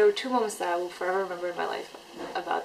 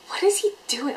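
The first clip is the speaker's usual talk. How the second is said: high, louder incredulous question; blurted out loud; 7 semitones higher, 7 dB louder than usual. Retold or imitated. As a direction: blurt it out in reenacted disbelief. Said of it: "Like, out loud".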